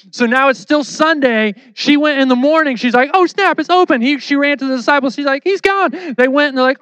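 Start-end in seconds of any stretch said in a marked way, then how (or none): 3.1-4.0 mock astonishment, pitched high; surprised discovery. Acted discovery of empty tomb. 5.4-5.9 high-pitched imitation of shocked news; mock alarm. Acted report of empty tomb.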